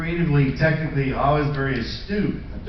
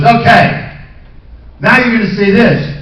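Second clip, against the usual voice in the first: loud, bossy director voice; imitating the director; 6 semitones higher, 9 dB louder than usual. Director feeding new lines, loud and bossy. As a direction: bark new lines at the actor. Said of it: "And he goes".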